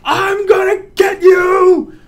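high, loud, slow playful threat; excited shout. Shown as a demonstration. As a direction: draw out the yell gleefully. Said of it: "that's not as fun as this one".

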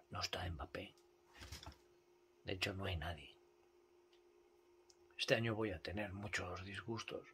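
whisper. Real, not acted. Sudden hush after shouting.

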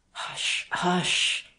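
ordinary delivery

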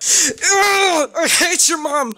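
high-pitched, hammed-up crying fit; mock tantrum cry. Joking about being punished by parents, mock crying tantrum. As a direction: wail it like a child throwing a tantrum. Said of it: "That's what I do whenever, whenever my parents beat me up".

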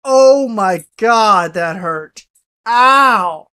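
loud frightened scream; panicking gamer. Real, not acted. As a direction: scream from a jumpscare.

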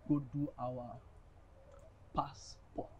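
breathless whisper of passport; whispered reveal. Acted. To his friend, whispering the big secret.

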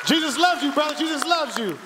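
high, loud, fervent preaching cry; full-force preaching. Acted. Pastor rallies the church to stand and praise, loud and high. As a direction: whip the congregation up and get them on their feet.

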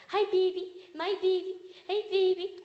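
high-pitched gushing greeting to a pet; hyped-up baby talk, pitched high; really hyped up. Acted. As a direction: coo excitedly at the dog. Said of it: "and really hyped up".

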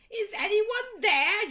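high, slow, drawn-out yell; hammed-up janitor yelling into the dark. A narrator's reading. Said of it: "yelled Mister Norris".

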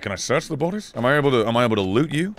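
ordinary talk, nothing marked

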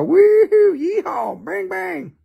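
high hammed-up yeehaw cowboy cheer; mock cowboy whoop.

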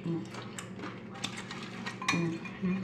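ordinary delivery

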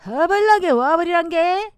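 loud, hearty Jeolla dialect; 전라도 사투리. Shown as a demonstration. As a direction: call them over, full-on Jeolla. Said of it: "전라도". (큰 소리의 전라도 사투리)

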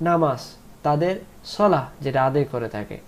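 normal talking voice

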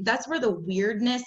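usual speaking voice

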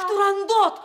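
high, loud cry of despair; exasperated outcry. Acted. Servant cries out the princess's name in despair. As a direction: throw up your hands and cry her name.

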